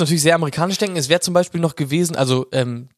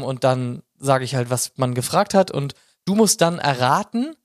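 normal talking voice

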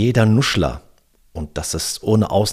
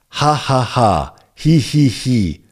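deliberate ha ha hi hi, higher and louder; forced laugh exercise; 4 semitones higher, 5 dB louder, slower than usual. Shown as a demonstration. Coach demonstrating the mood-lifting laugh drill, mock laughing. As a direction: fake a laugh on purpose to lift the mood. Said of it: "sag dir einfach".

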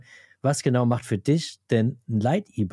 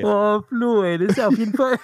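laughing; 9 semitones higher than usual. Real, not acted. Laughing at partner's answer.

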